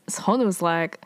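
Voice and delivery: ordinary talk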